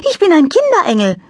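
high, light angel voice; little angel voice. A narrator's reading. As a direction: play a cheerful little angel. Angel character voice.

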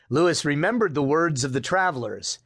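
usual speaking voice

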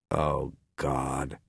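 low, soft stunned murmur. A narrator's reading. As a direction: breathe it out in shock. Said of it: "Havel murmured".

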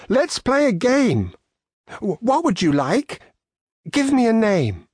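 put-on a boy eagerly inviting his toy animals to play; lightly voiced excited child; young Charlie.

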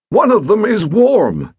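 fussy stepfather scolding; testy old gentleman. Acted. Pett accuses.

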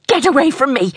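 high, urgent warning; alarmed protest. A narrator's reading. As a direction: fend off the men sharply. Girl fends off approaching men.

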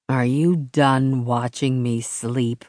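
low, slow sleepy growl. Acted. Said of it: "he growled".

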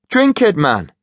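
high urging Geordie shout; Geordie accent. Acted. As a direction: push him to drink, Geordie. Finch urging.

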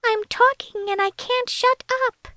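tiny, very high-pitched elf voice. A narrator's reading. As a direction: squeak it like a little elf calling for help. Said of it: "In an elf-like voice, Celia whispered in her ear".

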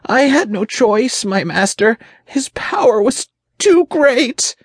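extremely high, panicked demon voice; high-pitched frightened squeal of an underling; breathless fear. A narrator's reading. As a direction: plead helplessly as a terrified imp. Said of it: "his voice breathless with fear".

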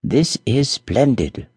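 slow, savoured "This is splendid"; a prince delighted with his new ring; pleased thought. Acted. Charming thinking to himself, slow and pleased. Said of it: "thought Charming".

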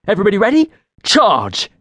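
rally everyone into the fight; leader's charge call; battle cry. Acted.

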